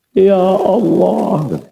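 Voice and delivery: high, drawn-out weeping "Ya Allah"; tearful prayer. Retold or imitated. Preacher reenacting leading night prayer, slow and weeping. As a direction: stretch it slowly as if about to cry.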